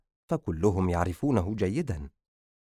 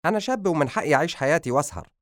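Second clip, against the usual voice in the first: higher, fast protest; indignant raised-pitch retort; defiant son; 7 semitones higher, faster than usual. Acted. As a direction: insist on your rights to a father. Son protests against father's scolding.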